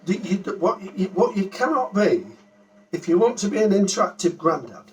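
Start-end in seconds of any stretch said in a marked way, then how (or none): none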